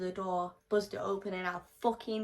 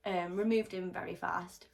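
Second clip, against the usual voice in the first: ordinary delivery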